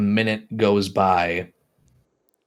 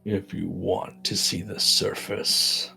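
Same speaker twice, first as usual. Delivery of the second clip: soft, slightly lowered creature voice; ghoulish creature; 7 dB quieter than usual. Acted. Game master's ghoul answers about the surface, soft and measured. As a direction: answer quietly as the looming ghoul.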